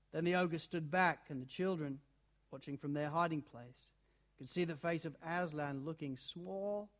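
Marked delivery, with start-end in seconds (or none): none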